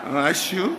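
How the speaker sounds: laughing Chungcheong greeting; playful Chungcheong accent; Chungcheong dialect. Shown as a demonstration. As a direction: lead the room in a Chungcheong hello. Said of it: "충청도 말로?".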